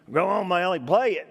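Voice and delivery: a hint of a big gruff man giving permission. Board chief tells nervous boy to play, mild gruff voice. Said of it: "big, gruff, six-foot-six gentleman says".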